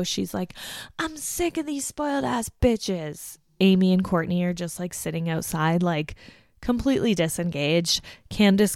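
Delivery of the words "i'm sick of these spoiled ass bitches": mock shrieked complaint, much higher; imitating a yell. Candace yelling from the patio, mock anger. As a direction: retell her screaming outside. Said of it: "She's still yelling on the patio".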